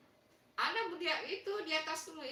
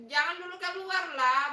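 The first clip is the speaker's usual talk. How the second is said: pleading protest; 3 semitones higher, 8 dB louder than usual. Real, not acted. Host begs a viewer not to leave, pleading protest. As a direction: call out to keep them from leaving.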